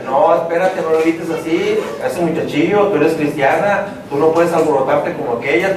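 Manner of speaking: low, restraining inner voice; voice of conscience. Acted. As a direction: drop the pitch and hold her back. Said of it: "y la otra vocecita".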